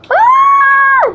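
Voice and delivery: high piercing scream in pain; real pain scream. Real, not acted. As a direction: scream out as the spice hits. Hot tamale scream.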